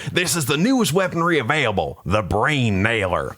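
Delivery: hammed-up sergeant voice; drill sergeant. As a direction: announce the weapon like a drill instructor.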